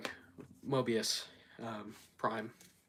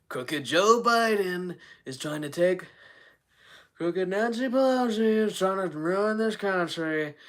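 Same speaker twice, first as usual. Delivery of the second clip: higher, slow, drawn-out politician impression; Donald Trump; 4 semitones higher, slower than usual. Streamer showing chat his impression, slow high Trump voice. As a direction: stretch out every word like a rally grievance. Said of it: "Like, I have my Trump voice".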